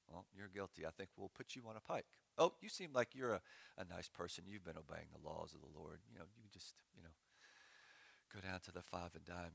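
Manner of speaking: lightly voiced sorting captives; Babylonian soldier. Pastor imagining Babylonians sorting people, mild mock-casual soldier. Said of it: "they're gonna be like".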